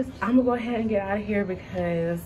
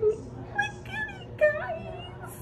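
much higher, slow delighted squeal; excited squeal; much slower than usual. Real, not acted. Vlogger showing viewers her new phone, high excited squeal. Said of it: "showing off new phone".

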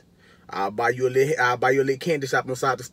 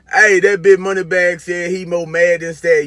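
loud, high, slow rant; heated yell; 6 semitones higher, 10 dB louder, slower than usual. Real, not acted. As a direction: shout it out at him, drawn out. Ranting at the rapper on screen.

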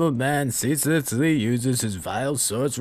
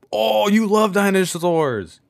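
excited; 9 semitones higher, 8 dB louder, slower than usual. Real, not acted. Delighted at dino shoes.

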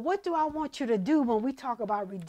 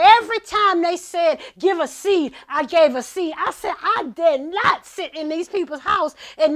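louder, higher, fired-up testimony; preaching at full force; 5 semitones higher, 8 dB louder than usual. Acted. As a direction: preach it, shouting the story to the church.

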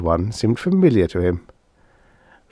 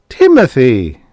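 loud scolding call; shout; 4 semitones higher, 9 dB louder, slower than usual. A narrator's reading. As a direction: call the pet sharply. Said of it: "Dana shouted".

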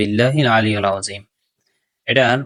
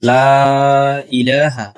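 লা ইলাহা ইল্লাল্লাহু drawn out slowly in Arabic recitation; 3 semitones higher, much slower than usual. Shown as a demonstration. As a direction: chant লা ইলাহা with the long Alif held. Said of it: "না হয় অর্থ পরিবর্তন হয়ে যাবে". (ধীরে টেনে আরবি তিলাওয়াত)

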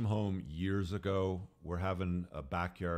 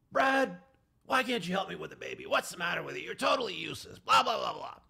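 high, loud mock shrieking at Brad; yelling at husband; 10 semitones higher, 3 dB louder than usual. Wife yelling at Brad in front of family, high mock shriek. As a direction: shriek at him as the scolding wife, going higher. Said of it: "she's yelling at her husband".

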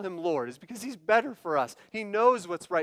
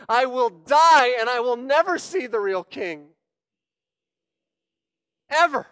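loud, stubborn defiance; defiant refusal; 6 semitones higher, 8 dB louder than usual. Pretend. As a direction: refuse the king with everything you have.

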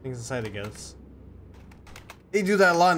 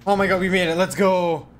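relieved laughter; 4 semitones higher, 7 dB louder than usual. Real, not acted. Made it through a hard part.

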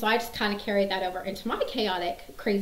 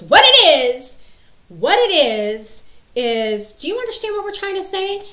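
arguing dad; 8 semitones higher, 12 dB louder than usual. Retold or imitated. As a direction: holler it like a movie dad mid-quarrel. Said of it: "and then the dad goes".

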